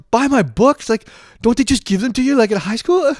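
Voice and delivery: high, loud, incredulous outburst; freaking out. Retold or imitated. New student told to buy his books, freaking out. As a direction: protest in comic panic. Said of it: "I just start freaking out".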